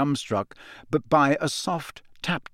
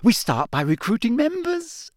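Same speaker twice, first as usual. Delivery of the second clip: happily; 11 semitones higher than usual. Acted. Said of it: "said Hermione happily".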